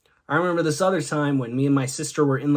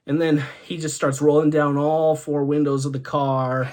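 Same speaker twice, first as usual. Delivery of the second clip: ordinary delivery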